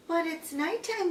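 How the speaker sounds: high little bat squeak; squeaked protest. A narrator's reading. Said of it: "Stella Luna squeaked".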